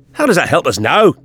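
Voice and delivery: high, impatient demand; exasperated cry. A narrator's reading. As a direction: cry out in frustration. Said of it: "cried Jamie".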